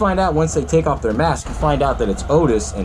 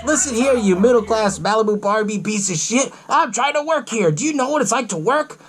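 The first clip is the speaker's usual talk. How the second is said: raised-pitch indignant scolding; mock angry rant; 9 semitones higher than usual. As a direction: berate a captive, playing it up. Acting Otis's rant at captive.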